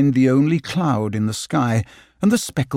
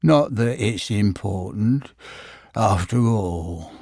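mopey Eeyore; 5 semitones lower, slower than usual. Acted. Eeyore voiced in story.

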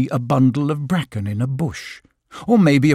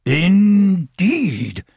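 a lightly voiced wise old owl, higher, conceding; 6 semitones higher, 3 dB louder than usual. Owl concedes to Christopher Robin, lightly voiced owl. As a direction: give it the owl's grudging agreement. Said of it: "conceded Owl".

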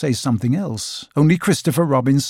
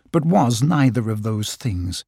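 ordinary delivery

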